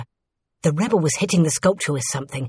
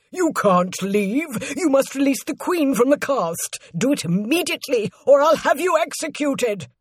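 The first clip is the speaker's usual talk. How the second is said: high, agitated commands; urgent, high-pitched servant making threats; shouted threat; 8 semitones higher than usual. A narrator's reading. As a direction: order him back in furious alarm. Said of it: "shouted Luton".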